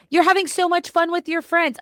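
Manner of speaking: put-on high, tempting party girl voice; giddy party girl. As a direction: coax like a tipsy friend. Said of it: "my little party girl voice is gonna be like".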